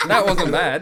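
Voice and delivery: high, loud, through laughter; real laughing protest. Real, not acted. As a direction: crack up while defending the attempt. Cracking up after own accent attempt.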